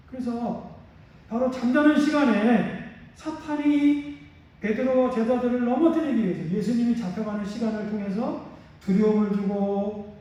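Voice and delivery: ordinary delivery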